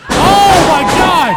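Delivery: sudden loud gasp of shock; shocked shout. Real, not acted. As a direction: blurt it out, stunned and loud. Commentator reacts to big move.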